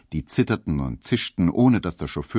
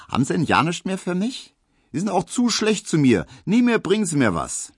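high, pouting Berlin maid voice; playful mock complaint, pitched up; teasing maid; 12 semitones higher, 3 dB louder than usual. Maid pouts at the postman in a high mock-complaining voice.

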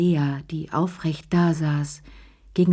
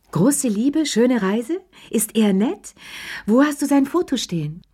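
high, lilting string of questions; eager prying; 7 semitones higher, 3 dB louder than usual. Acted. Madame Pelou quizzing Lea about her new lover, eager prying. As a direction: quiz her keenly, voice jumping up.

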